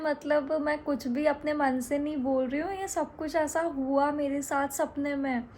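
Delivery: ordinary talk, nothing marked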